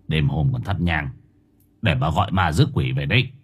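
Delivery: put-on low, gruffly irritated old husband; grumpy snapping. Husband objects to his wife lighting incense at night, irritated. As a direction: scold her low, without raising the voice. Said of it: "Ông Dầm gắt".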